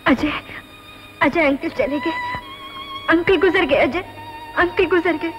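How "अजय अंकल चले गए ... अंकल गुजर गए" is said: distant crackly phone voice; desperate plea down a phone line; over the phone. Acted. Distressed caller over trunk line. (Phone पर रोती हुई आवाज़)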